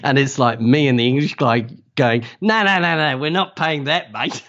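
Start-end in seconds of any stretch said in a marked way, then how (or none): 2.4-4.4 much higher, insistent no's, fading to mate; defiant refusal. Retold or imitated. Refusing to pay the huge driver, defiant. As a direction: stand your ground, half laughing at the nerve of it.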